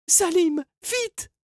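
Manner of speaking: very high, urgent plea; pleading cry. A narrator's reading. Margot begs Salim to act, high and pleading. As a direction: beg for help in two short cries. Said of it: "supplia Margot".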